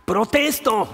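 bitter protest. Acted. Preacher voicing Job's complaint to God, mildly bitter protest. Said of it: "está amargado".

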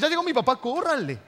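put-on high, loud alarmed kid's cry; children yelling a warning to flee; scared kids warning. Kids warning siblings as angry dad arrives, alarmed cry.

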